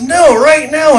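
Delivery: high, loud, urgent; insisting over the band; urgent yell. Retold or imitated. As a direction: shout it over the music. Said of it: "And he goes".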